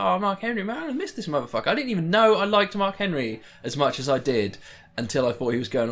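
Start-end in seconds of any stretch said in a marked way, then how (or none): 0.0-1.7 higher, gushing mock fan cry; a sudden higher burst of fond excitement; choked-up excitement. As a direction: relive the rush of fan joy. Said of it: "I was like".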